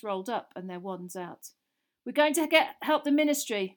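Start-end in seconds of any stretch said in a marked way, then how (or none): 2.0-3.7 loud and higher, shouting over noise; urgent shout over the din. A narrator's reading. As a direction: call out over the chaos. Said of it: "Mister Weasley shouted over all the noise".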